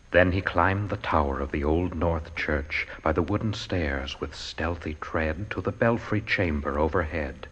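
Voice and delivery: ordinary delivery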